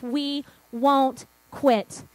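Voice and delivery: "We won't quit" very slowly, word by word; determined vow. Retold or imitated. Church founders vowing to God, determined and slow. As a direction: leave a deliberate pause before "quit".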